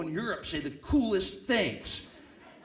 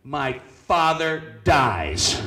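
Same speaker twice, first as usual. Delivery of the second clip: slow punchline; 8 dB louder, much slower than usual. Acted. Punchline delivered slowly.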